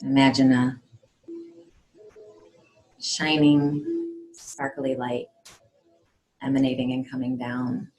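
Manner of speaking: measured meditation voice with long pauses; guided meditation. Acted. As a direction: guide them calmly, phrase by phrase.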